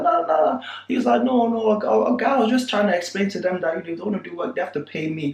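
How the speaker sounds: backing down, voice way up; retold pleading high voice; calmed-down Farouk. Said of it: "he calmed down".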